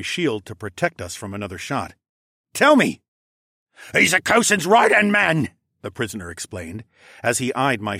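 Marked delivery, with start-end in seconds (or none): 2.5-3.0 high, loud order; forceful shouted command; urgent demand. A narrator's reading. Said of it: "I demanded". 3.9-5.5 high, loud, frightened voice; alarmed captive explaining; panicked explanation. A narrator's reading. Terrified prisoner explains who is shooting.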